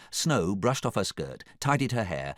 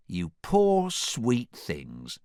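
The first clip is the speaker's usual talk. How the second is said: slow, drawn-out purr of sympathy; 4 semitones higher, much slower than usual. A narrator's reading. Doris pities the poor pair, purring slowly. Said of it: "purred Doris".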